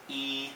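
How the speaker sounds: E held long and level; first tone. Shown as a demonstration. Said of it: "First tone is high and long".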